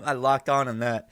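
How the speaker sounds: ordinary talk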